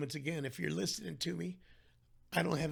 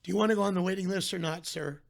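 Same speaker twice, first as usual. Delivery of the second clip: louder, fast, impatient brush-off; impatient receptionist; 3 semitones higher, 8 dB louder than usual. Retold or imitated. Receptionist pressing caller on hold, impatient and loud. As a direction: rush him off the line like a busy receptionist.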